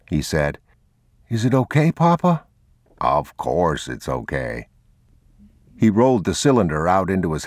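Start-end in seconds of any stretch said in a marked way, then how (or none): 1.3-2.4 put-on high child's voice; worried little boy, pitched high; young boy. A narrator's reading. Boy asking Papa about the pistol, high childlike voice. 3.0-4.7 low, slow fatherly comfort; calm reassurance. A narrator's reading. Man reassuring his son, low calm voice.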